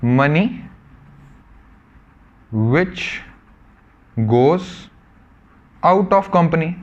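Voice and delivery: money which goes out of company, one word at a time with pauses; teacher dictating a definition; slow dictation. Shown as a demonstration. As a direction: dictate it slowly for notes. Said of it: "expenditure को क्या बोलेंगे".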